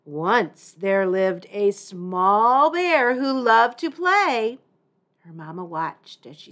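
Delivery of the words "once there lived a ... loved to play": deep rumbling voice. Acted. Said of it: "In a deep, rumbling voice".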